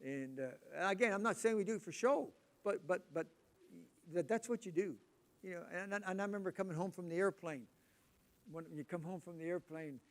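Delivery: ordinary talk, nothing marked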